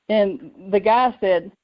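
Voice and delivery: plain reading voice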